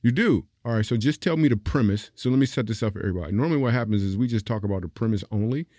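ordinary delivery

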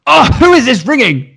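annoyed dad. Retold or imitated. Dad annoyed by repeated call.

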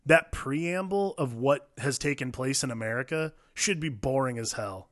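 usual speaking voice

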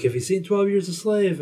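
high, pushy shouting TV host; imitating a host pressing a reluctant stranger; mock shout. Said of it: "He's like".

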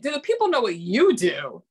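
ordinary talk

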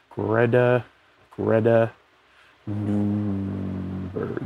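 "Greta", pause, "Greta", pause, long drawn-out "Nunberg"; absent-minded, halting sounding-out of "Greta Nunberg"; sounding out slowly. Real, not acted. Sounding out a name while searching.

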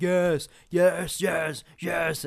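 high, eager monster cheering; delighted Fomorian boss. Retold or imitated. Fomorian boss applauding the bard's song, high and delighted. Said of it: "He's like".

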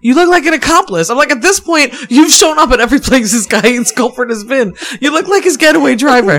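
high, animated retold scolding; exasperated warning. Partner warning her she looks like an accomplice, exasperated.

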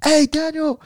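high-pitched mock holler; put-on high, eager call; retold excited calling out. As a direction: imitate an excited friend calling out big news. Friend calling out music news.

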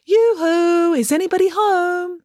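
put-on high, sing-song girl's call; pleasant feminine call. Loretta calls up to the dark house, sweet high voice. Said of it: "a very pleasant feminine voice call out".

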